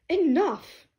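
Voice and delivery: high, loud cut-off; commanding outburst. Acted. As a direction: shut the squabble down with one sharp word. Class rep breaks up the squabble.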